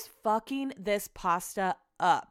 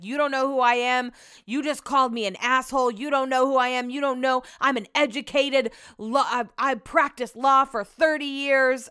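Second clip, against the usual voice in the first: raised pitch, indignant mock tirade; imitated aggressive rant; 4 semitones higher, 4 dB louder than usual. Retelling a jazz fan's rant at another guest, aggressive. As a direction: act out a man loudly pulling rank. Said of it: "super loud and aggressive".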